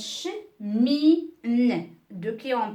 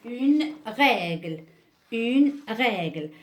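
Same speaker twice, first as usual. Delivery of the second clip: "règle" said slowly and deliberately; slow dictation; 3 dB louder, slower than usual. Shown as a demonstration. Teacher dictates "une règle" slowly to the class. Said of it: "teacher dictates example word".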